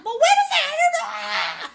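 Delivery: hammed-up frantic shriek, very high and loud; mock freak-out, breaking into a yell; aggressive, OCD painter. Teacher imitating a student fussing over the painting, frantic mock shout. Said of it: "aggressive or a little O C D".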